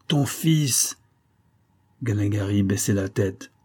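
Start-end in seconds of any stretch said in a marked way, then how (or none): 0.1-1.0 high-pitched menacing exclamation; repeated threat. A narrator's reading. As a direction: press the threat, pitch raised then falling. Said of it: "répétait Escovedo". (menace insistante, voix haute qui retombe)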